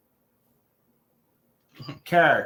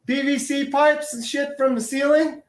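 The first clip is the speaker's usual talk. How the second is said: incredulous viewer; 9 semitones higher than usual. Retold or imitated. Mocking a viewer's reaction.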